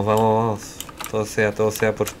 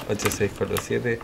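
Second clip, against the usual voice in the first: ordinary delivery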